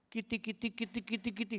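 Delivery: playful parody of a repeated prayer sound; imitating friend's tongues. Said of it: "kok bahasa lidah itu selalu sama".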